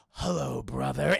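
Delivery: low-pitched dramatic rival; low theatrical sword-fight taunt; mock villain greeting. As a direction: greet your nemesis with hammed-up menace. Acted villain greeting his rival.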